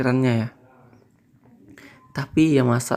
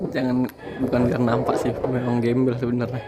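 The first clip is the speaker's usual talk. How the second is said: laughing. Real, not acted. Joking about his messy hair.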